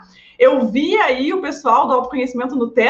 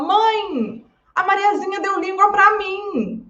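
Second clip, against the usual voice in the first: put-on higher, whiny child complaining to mom; a small daughter tattling through tears, pitched up; upset little girl; 7 semitones higher, slower than usual. Child telling mom about the girl who stuck out her tongue, whiny. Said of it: "né, super chateada".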